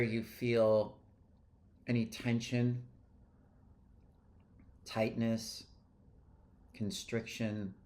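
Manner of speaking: unhurried, easing them into the body; guided meditation. Real, not acted. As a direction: lead a calm energy clearing, leaving space after each phrase. Leads an energy clearing meditation.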